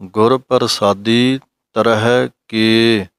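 ਤਰਹਿ with a break, then ਕੇ separately; slow phrasing that keeps ਤਰਹਿ and ਕੇ apart; pause before ਕੇ. Shown as a demonstration. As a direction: recitation phrasing demo. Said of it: "ਤਰਹਿ ਕੇ ਪਾਠ ਇਕੱਠਾ ਨਹੀਂ ਹੈ".

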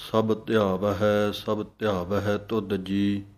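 slow, measured scripture recitation. Acted. Teacher reciting the shabad line by line for students, slow and measured. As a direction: recite each line slowly and reverently.

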